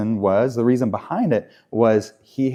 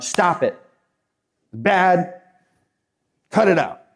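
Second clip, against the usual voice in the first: mock scolding; 5 semitones higher than usual. Mocking church scolding sin.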